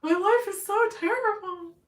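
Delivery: high, loud, hammed-up whine; mock self-pitying wail. Mocking her own complaints about bad luck, self-pitying wail. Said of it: "and then I'm like".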